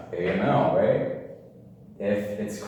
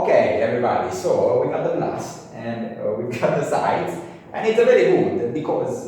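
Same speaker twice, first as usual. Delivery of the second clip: put-on loud, jokey Italian accent; 3 semitones higher, 7 dB louder than usual. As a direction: ham up an Italian accent, pitch climbing. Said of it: "let's throw in an Italian accent".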